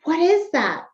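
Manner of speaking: slow, sing-song showing voice; reading to a child. Shown as a demonstration. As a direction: point at the picture and marvel for a child. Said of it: "you could say".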